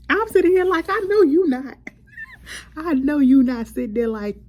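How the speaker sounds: high, loud, outraged disbelief; outraged exclamation. Real, not acted. Ranting at Natalie on the show, loud outraged disbelief. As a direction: blast it out at the screen in disbelief.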